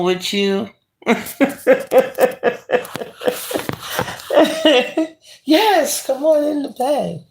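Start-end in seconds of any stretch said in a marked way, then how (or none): 1.1-5.1 breathy high giggling fit; high giggle. Real, not acted. Laughing at a viewer's request to cuddle. 5.4-7.2 much higher, giggling invitation; playful giggly invite. Real, not acted. Inviting a chat viewer into bed, high and giggly. Playful reply to cuddle request.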